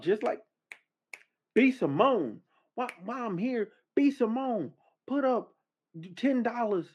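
high, heated callout; indignant callout. Real, not acted. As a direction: call her out in disbelief. Calling out B Simone.

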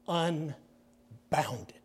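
hushed ominous whisper; sermon's chilling whisper. Real, not acted. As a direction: breathe it out darkly. Dramatic end of rage point.

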